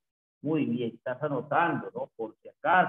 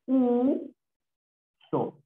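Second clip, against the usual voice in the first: "uso" as a long high u... then so; raised, held first vowel in "uso"; syllable by syllable; 7 semitones higher than usual. Shown as a demonstration. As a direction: stretch the "u" of "uso", pause, then so. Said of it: "sería".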